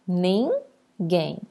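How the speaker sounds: "ninguém" in two separate beats, nin, guém; split into syllables. Shown as a demonstration. Teacher separating the word for pupils, "ninguém" syllable by syllable. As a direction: pause between the syllables of "ninguém". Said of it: "eu separo".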